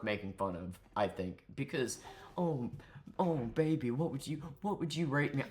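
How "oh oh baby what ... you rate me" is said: softly moaned come-on; lightly hammed-up sultry voice; mock seductive. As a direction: imitate a guy fishing for compliments. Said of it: "talking like this all seductively".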